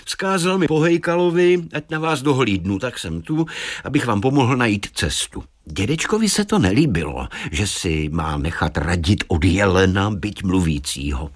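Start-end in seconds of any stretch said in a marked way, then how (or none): 0.0-5.4 lightly voiced helpful deer, pitched up at first; talking deer. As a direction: explain obligingly as the deer. Narrator plays the talking deer. (ochotný mluvící jelen)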